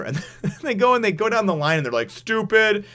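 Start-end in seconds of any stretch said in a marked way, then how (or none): 2.1-2.9 high-pitched jeering name-call; mock insult. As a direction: toss it out as a high, mocking jab. Acting out name-calling down the line.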